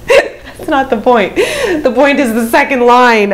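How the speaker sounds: imitating helpless laughter while explaining; retold laughing fit. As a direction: squeeze the words out between laughs. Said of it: "Oh, I laughed".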